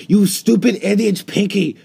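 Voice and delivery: loud, high mock-angry yell; exasperated yell. Real, not acted. Player yelling at villager Pinky, mock-angry yell. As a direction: scold the villager at full voice.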